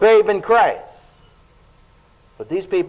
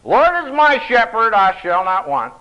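higher, louder imitation of an elder reciting; old elder reciting; 6 semitones higher, 6 dB louder than usual. Pastor mimicking the old church elder at testimony time, raised.

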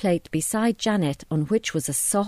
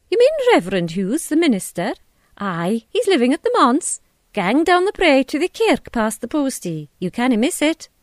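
higher, louder broad Scots directions; Scottish landlady; 6 semitones higher, 5 dB louder than usual. A narrator's reading. Tea shop owner directs Carol to the manse, broad Scottish voice. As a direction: point the way, hearty and brisk. Mrs MacDonald gives directions.

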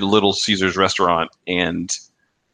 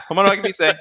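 higher, sing-song phone greeting; commercial phone greeting; 10 semitones higher than usual. Retold or imitated. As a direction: answer the phone like the ad. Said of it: "I still say that".

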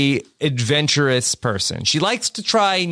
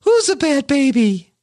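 put-on baby voice; 7 semitones higher than usual. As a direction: scold like cooing a baby. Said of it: "with the baby voice".

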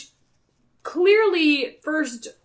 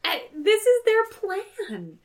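mock high voice; 10 semitones higher, much slower than usual. Mocking the plan.

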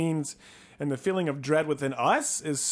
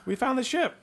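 excited; 11 semitones higher than usual. Acted. Spotting the lost ship.

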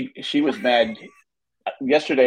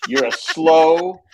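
drawn-out insult, leaning loudly on slow; mock lecturing, very slow, dropping away at the end; scolding child's lecture; 6 semitones higher, 5 dB louder, much slower than usual. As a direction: imitate a kid's deliberate put-down. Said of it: "She's just giving me a lecture".

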